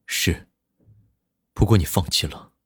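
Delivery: low, soft admission; a hint of a quiet boss; quiet low man. A narrator's reading. Nan Lichuan quietly.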